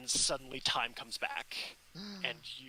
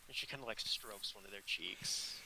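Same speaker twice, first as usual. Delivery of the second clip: ordinary delivery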